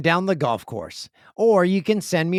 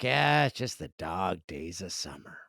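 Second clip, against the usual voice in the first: bored summer sigh; 10 semitones lower, 7 dB quieter than usual. Retold or imitated.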